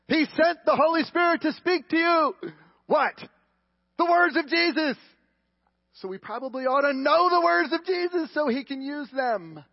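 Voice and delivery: high, loud, full-force proclamation; emphatic preaching shout. Real, not acted. Preacher pressing the congregation to know Jesus' words, shouting. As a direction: drive the point home at the top of the voice. Preacher driving the point home.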